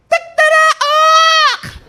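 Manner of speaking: loud high drawn-out cock crow; rooster crow. Acted. Pastor imitating the rooster, loud cock-a-doodle.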